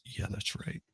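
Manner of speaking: breathy voiceless purr; sultry whisper. Retold or imitated. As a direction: murmur it like sweet nothings. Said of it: "And I'll just".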